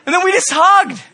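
high, loud laughing delivery; laughing with joy. Retold or imitated. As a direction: laugh through the words. Laughing through joyful retelling.

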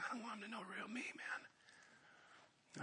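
fearful whispered confession. Retold or imitated. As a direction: whisper it like someone afraid of being known. Voicing a hiding churchgoer's thought.

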